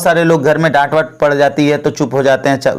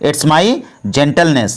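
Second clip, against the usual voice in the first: very slow, every word laid out for learners; slow, careful model sentence; slow teaching demo; much slower than usual. Shown as a demonstration. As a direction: spell it out slowly for the class. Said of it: "इसको English में बोलना होगा तो क्या बोलेंगे?".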